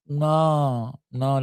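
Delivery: ordinary talk, nothing marked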